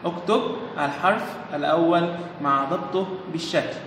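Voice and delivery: dictating the line at a slow pace; slow dictation so children follow; slow read instruction. Shown as a demonstration. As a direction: read the instruction slowly and deliberately for the class. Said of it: "بيقول إيه؟".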